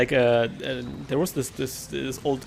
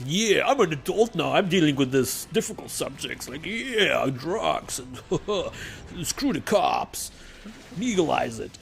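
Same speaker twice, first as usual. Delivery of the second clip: mock teenager; 3 semitones higher than usual. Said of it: "But as teenagers, like".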